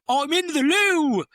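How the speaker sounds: high, loud, slow posh English shout; posh English accent. Retold or imitated. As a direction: call out like a flustered Englishman. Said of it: "and he's like".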